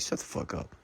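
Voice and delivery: a hushed, biting whisper; whispered thought. Real, not acted. Thinking silently about the complaining women, in a harsh whisper. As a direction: whisper it under your breath. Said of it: "I'm thinking, like".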